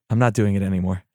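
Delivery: ordinary talk, nothing marked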